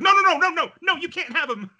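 very high, mock-frantic objection; panicked protest. [name] suddenly notices the teasing, protesting in alarm. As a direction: burst in with a squeaky mock panic.

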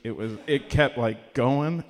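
ordinary talk

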